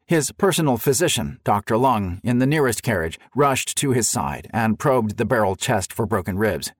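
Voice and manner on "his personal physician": ordinary delivery, nothing marked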